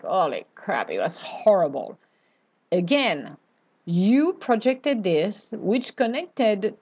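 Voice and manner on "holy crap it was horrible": low-pitched acted grumble; mock disgusted complaint. Speaker voices someone leaving the dentist, mock disgusted. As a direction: grumble it lower, playing let down. Acts out leaving dreaded appointment.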